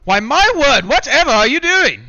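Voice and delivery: high, loud, startled soldier; flustered soldier voice. Acted. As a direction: exclaim it high and loud in character. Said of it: "you says".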